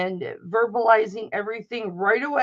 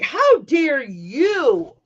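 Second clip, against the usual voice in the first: slow, louder, every word leaned on; indignant retort; 3 semitones higher, 6 dB louder, much slower than usual. Retold or imitated. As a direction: throw it back at her, outraged. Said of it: "And I'm like".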